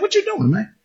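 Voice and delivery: high, soft bemused question; puzzled question. Retold or imitated. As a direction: ask it squinting, puzzled. Joshua questions Moses at rock.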